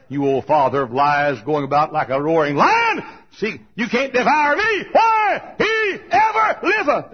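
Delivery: high, loud revival shout; full-force preaching. Real, not acted. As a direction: build to a ringing shout.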